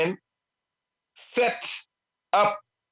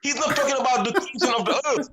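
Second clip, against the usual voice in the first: fast and amused, laughing, higher; 5 semitones higher, much faster than usual. Real, not acted. Guest laughs while correcting the host's reading. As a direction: rattle it off through a laugh.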